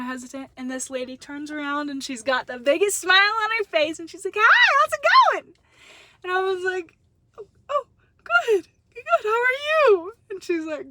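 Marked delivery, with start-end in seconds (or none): 4.4-5.5 much higher and louder, bright chirpy hello; bubbly greeting. Retold or imitated. Cheerful employee greeting retold. 7.4-10.1 mock choked-up reply.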